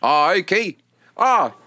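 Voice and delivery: put-on New Zealand vowels, mildly bewildered; New Zealand accent. Shown as a demonstration. Comic doing his New Zealand on request, New Zealand accent. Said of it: "Do your New Zealand".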